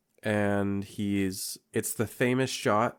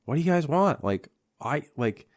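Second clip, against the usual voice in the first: higher-pitched acted complaint; mock exasperation; 6 semitones higher, faster than usual. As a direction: imitate a fed-up pet owner. Billy exasperated at jumping creatures.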